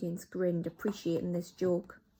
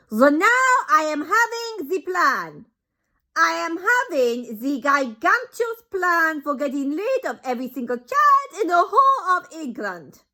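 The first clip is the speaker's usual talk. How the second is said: scheming Grand High Witch; 10 semitones higher, 12 dB louder than usual. Acted. Grand High Witch reveals her plan, loud accented.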